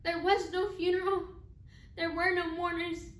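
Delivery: a bereaved mother forcing out each hard fact; anguished grief. Acted. Acted mother recounts burying son alone.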